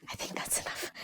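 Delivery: a sighing, breathy whisper; tired whisper. Real, not acted. Teacher, done with the list, whispers wearily. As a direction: whisper it as if worn out.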